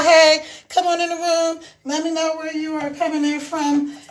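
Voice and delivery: normal talking voice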